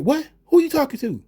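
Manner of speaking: high-pitched mock indignant challenge; acted-out affronted outburst. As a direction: flip suddenly into a high, offended comeback. Acts out flipping out of accent.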